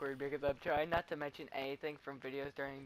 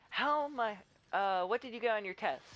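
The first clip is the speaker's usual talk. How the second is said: raised, higher classmate's shout; retold yell; 6 semitones higher, 4 dB louder than usual. Kid yelling across class about test scores, retold yell. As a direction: call it out across the room. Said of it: "a kid yelled out in class".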